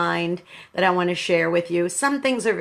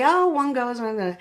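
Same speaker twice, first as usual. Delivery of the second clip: mock flippant; 4 semitones higher than usual. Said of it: "I don't mean to be flippant and just say".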